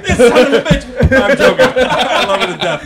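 loud, high laughter through every word; laughing. Real, not acted. Comedian laughing at his own nonna joke. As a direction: crack up while talking.

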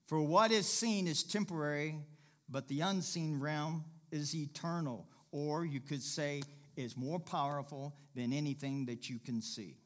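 ordinary talk

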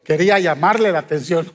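usual speaking voice

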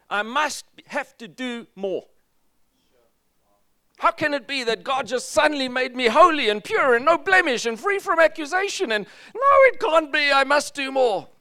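high, loud disbelieving protest; anxious doubter. Acted. A believer who can't accept grace, high and loud. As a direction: protest that it is too good to be true.

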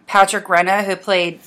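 ordinary talk, nothing marked

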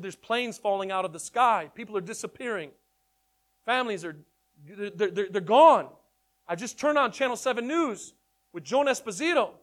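panicked rush. Retold or imitated. Son on the phone telling his mom about the vanished people, frantic and loud. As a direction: blurt out the end-of-the-world news, alarmed.

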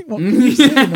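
high, loud, half-laughing call down a bad line; ferry lady straining. Ferry lady can't hear, high loud straining voice.

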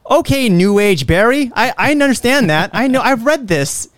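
mock him as a know-it-all; sarcastic mockery. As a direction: owner mocking the stranger's dog advice. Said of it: "I'm like".

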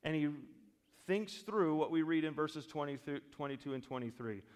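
ordinary delivery

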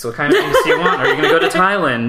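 high laughing mock question. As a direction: tease it through laughter. Mocking intrusive interview questions.

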